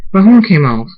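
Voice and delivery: high, loud exclamation falling away; shocked cry. A narrator's reading. Zara finds her horn on the floor, shocked. Discovers horn lost in explosion.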